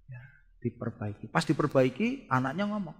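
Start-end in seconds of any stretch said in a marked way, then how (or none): none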